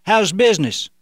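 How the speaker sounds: loud friendly call across the station; yelling out. Acted. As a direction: call out loudly to the back. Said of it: "he yelled out".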